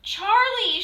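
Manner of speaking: high, loud call for her husband; wife calls her husband, shouting high. A narrator's reading. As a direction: shout his name across the house. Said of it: "shouted Missus Newcomb".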